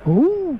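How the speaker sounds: high, swooping clownish "uuuh"; short mock wail, rising then falling; signature clown cry. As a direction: give the famous pretend-weeping hoot. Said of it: "ese sonido que te ha dado fama".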